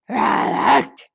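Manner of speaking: loud breathy monster snarl; monster roar sound. A narrator's reading. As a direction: rasp out a beast noise.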